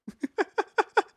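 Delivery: short high giggle; high squeaky laugh. Real, not acted. Laughs at plush badge.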